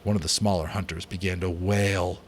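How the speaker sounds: usual speaking voice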